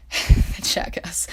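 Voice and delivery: laughing through the words. Real, not acted. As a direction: giggle the words out. Laughing while repeating.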